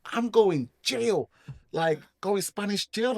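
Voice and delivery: high, drawn-out dismay on jail; retold alarm. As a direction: stretch it out in mock dread. Retold dread of Spanish jail.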